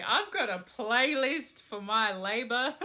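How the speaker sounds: laughing loudly through the words. Real, not acted. Expectant mother tells the reader her labor plans, laughing. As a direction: giggle it out, thrilled.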